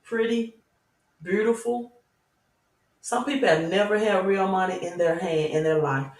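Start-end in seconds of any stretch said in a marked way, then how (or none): 0.0-1.9 sweet Southern "pretty, beautiful"; Southern accent demo, lightly done. Shown as a demonstration. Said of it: "In my southern accent".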